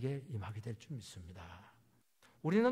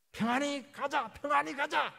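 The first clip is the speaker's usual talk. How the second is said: high, raised acted cry; urgent exhortation; 13 semitones higher, 6 dB louder, slower than usual. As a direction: call it out insistently, pitched high. Preacher voices an urgent exhortation.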